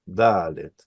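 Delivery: Dalet, low, leaning on the long first A; stress on first A. Shown as a demonstration. Teacher repeats the word for students, Dalet stressed on the A. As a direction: stretch the A in Dalet, falling after it. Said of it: "el acento va a estar en esta A".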